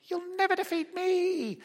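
put-on high childish voice, playful; little boy's boast. Preacher voicing his little son mid-wrestle, high and playful. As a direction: imitate a tiny son's mock threat. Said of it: "he'd say things like".

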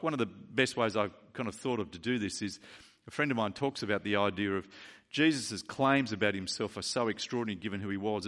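ordinary talk, nothing marked

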